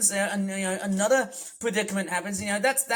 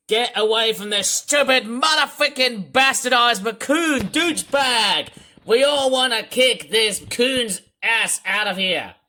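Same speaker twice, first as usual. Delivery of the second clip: loud, heated swearing; angry cursing; 4 semitones higher, 9 dB louder than usual. Real, not acted. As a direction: spit insults at the enemy. Cursing the raccoon boss.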